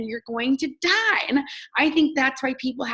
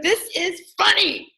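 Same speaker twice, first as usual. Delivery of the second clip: high, slow, bold declaration; bold and sure; 7 semitones higher, slower than usual. Retold or imitated. Comic telling a shy crowd she's bold, firmly. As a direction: say it like you own the room. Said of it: "you gotta be bold".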